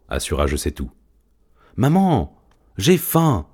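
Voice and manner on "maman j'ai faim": hungry chick cutting in. Acted. Chick interrupting the cats to Zorba, high hungry plea. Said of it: "coupa le poussin".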